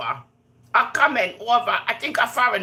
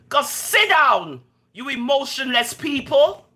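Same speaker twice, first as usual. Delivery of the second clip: higher, louder, slow scold; scolding dismissal; 7 semitones higher, 4 dB louder than usual. Real, not acted. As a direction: tell them off slowly and firmly. Scolding her critics.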